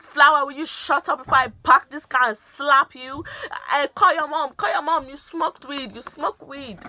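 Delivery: loud, high threatening rant; imitating angry dad. Dad threatening friend in the car, mock angry rant. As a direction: threaten like a furious father.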